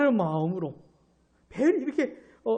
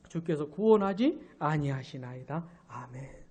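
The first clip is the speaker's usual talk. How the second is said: scripture reading; 6 semitones lower, 8 dB quieter than usual. Acted. Reads the verse aloud.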